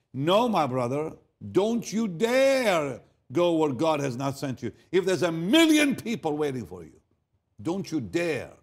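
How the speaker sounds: loud, high, impassioned admonition; stern pulpit cry, drawing out key words; forceful preaching warning. Real, not acted. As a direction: warn them with full preacher's force. Preacher sternly warns the congregation.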